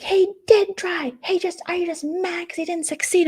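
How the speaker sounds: high mock-outraged wail; mock screaming argument. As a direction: imitate someone screeching in a row, without full volume. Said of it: "they start screaming at each other".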